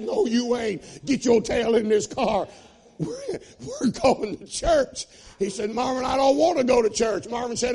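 high, loud, fast-rising revival preaching; preaching at full force. Acted. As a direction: shout the testimony to the rafters.

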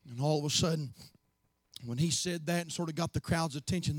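put-on softly telling of the little boy; hushed storytelling. A narrator's reading. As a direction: drop low and quiet for the story. Quiet storytelling hush.

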